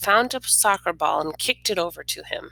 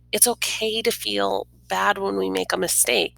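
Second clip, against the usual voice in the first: usual speaking voice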